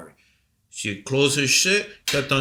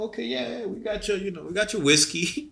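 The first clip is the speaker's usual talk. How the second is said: mock eager; 10 semitones higher than usual. Mocking the offering exchange.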